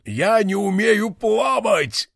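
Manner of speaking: high, slow panicky cry through water; gurgling. Acted. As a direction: flail and plead while drowning. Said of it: "пробулькал кулон".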